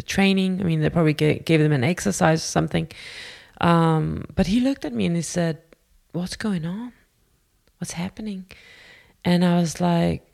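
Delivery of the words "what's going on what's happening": very soft questioning; retold gentle concern. Teacher to shaken student in his office, soft concern. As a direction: ask gently, voice dropped to a hush.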